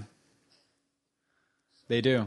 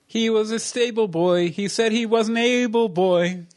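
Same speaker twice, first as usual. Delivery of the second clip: playful pun voice; 7 semitones higher than usual. Acted. Joke pun in high slow voice.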